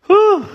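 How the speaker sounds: breathless whew. Real, not acted. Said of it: "once I catch my breath".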